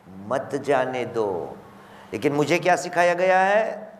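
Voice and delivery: rising and falling sermon delivery with chanted Quranic verses; impassioned preaching. Acted. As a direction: preach it to the gathering, swelling on the key lines. Majlis preaching throughout.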